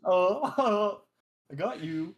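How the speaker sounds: high, loud gotcha on "I got you", rising at the end; spring the voicemail gotcha with a rising "I got you"; fake voicemail prank voice. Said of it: "those voicemails that, like, pretend".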